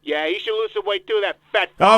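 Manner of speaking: put-on voice of a self-important politician; Donald Trump voice. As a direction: interrupt like a pompous candidate. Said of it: "let's get rid of Mister Trump".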